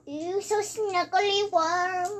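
slow, sing-song cutesy anime voice; anime girl voice. Acted. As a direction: coo it like a cute anime girl. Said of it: "that was an anime girl voice".

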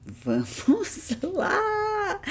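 high, drawn-out excited cheer. Acted.

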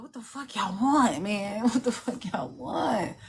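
slow, drawn-out exasperated grumble; weary irritation at the pings, half laughing; fed-up slow groan of a question; annoyed at work. Retold or imitated. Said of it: "I be in there looking like".

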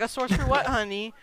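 lightly voiced mom, pitch lifted. Mother asking her kid about mystery money, lightly acted mom voice. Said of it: "my mom was like".

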